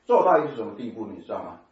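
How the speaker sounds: ordinary talk, nothing marked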